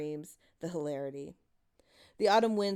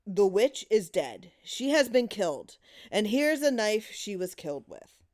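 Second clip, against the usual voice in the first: solemn announcement; 3 semitones higher, 6 dB louder than usual. Acted. Husband leads the grim game.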